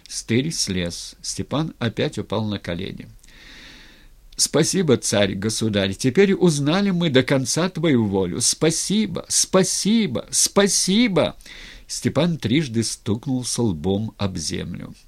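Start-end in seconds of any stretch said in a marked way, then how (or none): 4.4-11.4 high, emphatic thanks building with each repeat. A narrator's reading. Razin bowing to the mock tsar, emphatic thanks. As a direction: pile up the thanks ever more fervently.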